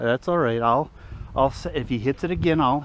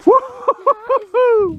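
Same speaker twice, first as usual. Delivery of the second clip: whooping; 9 dB louder, much slower than usual. Real, not acted. Celebrating the catch.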